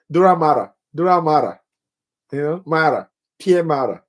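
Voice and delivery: "matter" said "mara", the t tapped like a quick r; flapped t in matter. Shown as a demonstration. Teacher savouring his own accent on "matter", with a flapped t. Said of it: "I'm listening to my accent".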